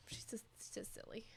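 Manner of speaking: hushed whispered aside; whispering. Real, not acted. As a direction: whisper it softly. Aside about cat.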